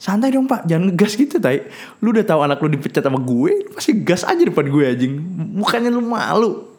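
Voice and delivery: mock outrage, words stretched at the end; indignant retort. Retorting to angry father.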